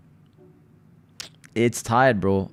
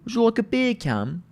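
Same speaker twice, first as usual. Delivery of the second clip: high, posh-mocking British voice; hammed-up British beer can; British accent; 10 semitones higher than usual. Shown as a demonstration. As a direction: ask it like a cartoon English butler. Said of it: "if you say beer can in a British accent".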